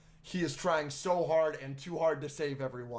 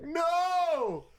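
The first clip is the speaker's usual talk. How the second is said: high, loud mock cry of no; imitating the wife's horrified shout; shocked no; 6 dB louder than usual. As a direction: yelp it out in mock dismay. Retelling wife's shocked reaction.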